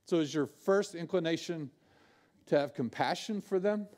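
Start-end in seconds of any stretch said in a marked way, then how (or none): none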